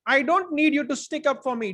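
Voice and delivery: shouted. A narrator's reading. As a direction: shout back, blasting the helper. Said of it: "Donald shouted".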